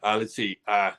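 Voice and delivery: ordinary talk, nothing marked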